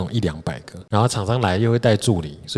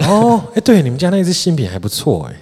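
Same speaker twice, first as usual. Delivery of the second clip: fake sudden recognition; 9 semitones higher, 5 dB louder than usual. Faking recognition to client.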